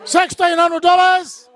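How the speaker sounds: rapid auction chant, calling bids; auctioneer calling. Acted. Runs the charity auction.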